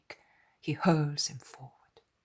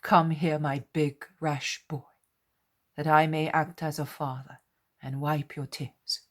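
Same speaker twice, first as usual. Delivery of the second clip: louder, fatherly comforting voice; tender Jesus; 8 dB louder than usual. A narrator's reading.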